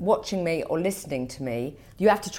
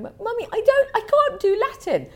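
put-on much higher, a child whining to mum; whiny daughter; 4 dB louder than usual. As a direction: play the sulky schoolgirl. Mother voices daughter complaining.

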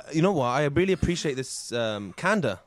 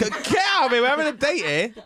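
shouting; 10 semitones higher, 3 dB louder than usual. Acted. Shooing the hype man away.

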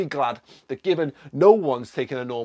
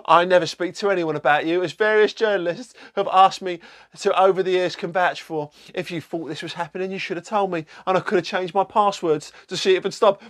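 lightly voiced, higher mock reply; Rebecca's reply; 6 semitones higher, 4 dB louder than usual. Denying she leaked to journalists, lightly put-on voice. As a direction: read her out with a raised pitch. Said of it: "So she's replied".

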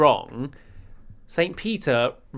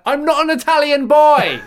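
retold yell; 14 semitones higher, 9 dB louder than usual. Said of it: "I was like".

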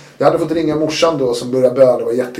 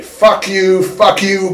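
higher, louder mock yell of triumph; retold joyful yell; 7 semitones higher, 5 dB louder, much slower than usual. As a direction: relive the outburst, half-shouting it. Retelling wild joy at release. (återberättat glädjevrål)

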